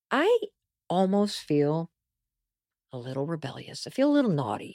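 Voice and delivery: low, soft confiding voice; hushed confession. Real, not acted. Host admits a naughty bedtime feeling to listeners, hushed confession.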